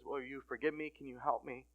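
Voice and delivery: small, hushed plea, lightly imitated; soft plea. Child to dad, asking forgiveness, soft plea. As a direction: quietly ask for forgiveness.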